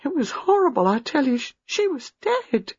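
put-on high, halting, traumatised young woman; haunted recollection rising to high distress; horrified. A narrator's reading. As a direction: relive it in fragments, building to anguish. Reliving seeing a strangled woman.

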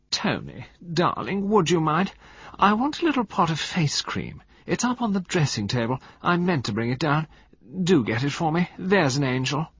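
high, coaxing society-wife voice; affected wheedling. A narrator's reading. Wife sending her husband for face cream, affected wheedling. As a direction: coax him sweetly, pitched high.

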